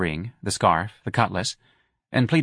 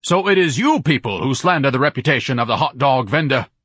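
higher, louder outraged charge; indignant accusation; 5 semitones higher, 4 dB louder than usual. A narrator's reading. Ignatius accuses the ladies of slander, indignant. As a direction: accuse them furiously.

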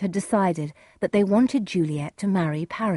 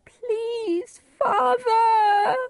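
very high, tearful wail; desperate tearful plea; crying out; 4 dB louder, much slower than usual. Acted. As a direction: beg in a drawn-out sob. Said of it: "Juliet cried".

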